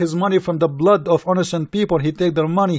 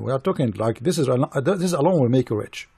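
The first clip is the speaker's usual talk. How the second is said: normal talking voice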